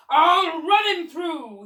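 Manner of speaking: slow, loud and high battle cry; shout. Acted. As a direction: roar it like a charging fencer. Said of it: "he shouted".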